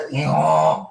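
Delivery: short rising donkey bray; little donkey sound. Acted. Ending the donkey's line with a playful bray. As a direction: tack a little bray onto the end. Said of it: "the little donkey sound at the end".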